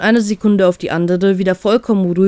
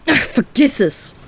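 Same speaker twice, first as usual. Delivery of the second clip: higher, slow irritated brush-off; angry dismissive growl; 6 semitones higher, slower than usual. Acted. Crewmate gives up on the captain, mildly angry dismissive growl. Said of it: "knurrt Sashi wütend mit einer abfälligen Handbewegung".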